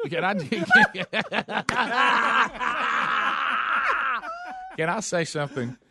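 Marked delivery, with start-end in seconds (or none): 0.4-4.7 shrieking laugh. Real, not acted. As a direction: laugh until you squeal. Laughing fit at hat.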